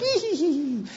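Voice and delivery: put-on giggling kids voice; high giggle imitation. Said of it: "they're like".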